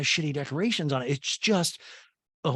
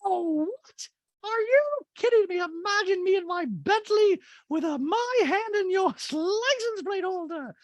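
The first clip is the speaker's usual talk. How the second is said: Dorit voice. Retold or imitated. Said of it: "And Dorit goes".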